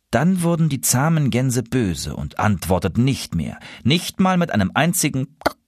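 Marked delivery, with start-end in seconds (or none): none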